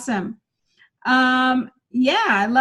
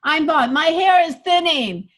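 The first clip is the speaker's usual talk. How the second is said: proud, louder brag, slowing at the end; attitude; 4 dB louder than usual. Shown as a demonstration. Coach demos a bald joke with swagger. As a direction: own the baldness with swagger. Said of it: "find some advantages".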